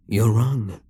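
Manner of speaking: ordinary talk, nothing marked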